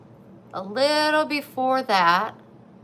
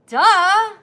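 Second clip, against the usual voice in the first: mock duh; 11 semitones higher, 11 dB louder than usual. Mocking her own mistake.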